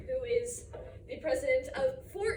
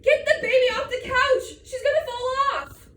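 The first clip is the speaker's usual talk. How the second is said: high, loud panicked shout; frantic mother's shout; alarmed mom yelling; 8 semitones higher, 11 dB louder than usual. Acted. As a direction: yell an urgent warning across the room. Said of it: "My mom thinks I should say".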